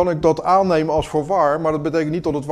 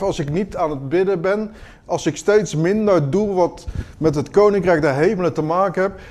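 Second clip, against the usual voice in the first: usual speaking voice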